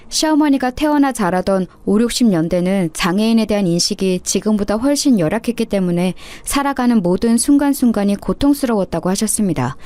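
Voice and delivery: ordinary delivery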